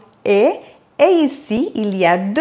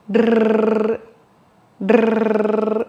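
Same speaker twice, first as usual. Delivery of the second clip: Rrr trilled gently, softer; soft rolled R. Shown as a demonstration. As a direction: let the air pass softly on a trill. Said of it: "laissez passer l'air doucement".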